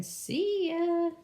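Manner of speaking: high-pitched playful critter voice; little critter. Pretend. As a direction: call it like a tiny creature.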